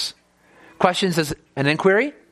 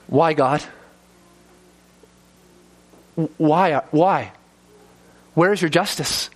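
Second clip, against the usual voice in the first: humble plea; slower than usual. Retold or imitated. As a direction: ask God humbly, not accusing. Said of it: "humbly asking God".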